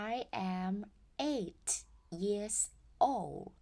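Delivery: put-on I am eight years old very slowly; teacher modelling slowly; slow model sentence. Shown as a demonstration. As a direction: stretch each word for learners. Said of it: "บอกอายุ".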